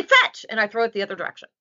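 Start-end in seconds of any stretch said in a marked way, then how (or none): 0.0-0.5 high, bright "Fetch"; sharp call to the dog to go fetch; called command. Acted. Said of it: "and I say".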